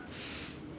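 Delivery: "S" as a held hiss; S sound. Shown as a demonstration. Teacher showing double S mid-word, sustained hiss. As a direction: say "sss" for "S", voiceless, no letter name. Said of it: "on le prononce".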